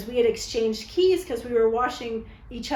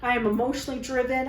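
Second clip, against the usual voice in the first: usual speaking voice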